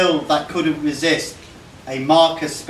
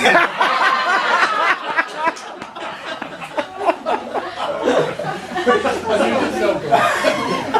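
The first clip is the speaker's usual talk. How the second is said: high, rolling laughter; can't stop laughing; long laughing fit; 12 semitones higher than usual. Real, not acted.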